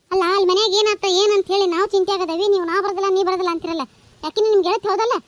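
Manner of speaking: loud, exasperated telling-off; angry scolding. Acted. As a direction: shout at him to get moving. Wife scolds husband for refusing. (ಸಿಟ್ಟಿನಿಂದ ಜೋರಾಗಿ ಬೈಯುವುದು)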